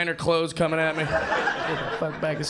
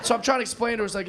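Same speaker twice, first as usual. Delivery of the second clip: ordinary delivery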